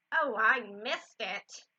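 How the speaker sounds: loud, drawn-out lament; slow, exaggerated dismay; Mama. A narrator's reading. Mama missing a shooting star, loud dismay. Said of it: "I forgot Mama's accent".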